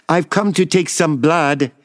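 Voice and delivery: put-on high, lightly Chinese-accented nurse. A narrator's reading. Needle lady arriving to draw blood, Chinese accent. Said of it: "a voice with a Chinese accent".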